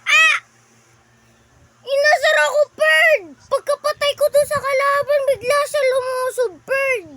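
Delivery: loud, high outraged shouts; indignant yelling. Real, not acted. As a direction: protest loudly to Perd. Yelling after being taunted.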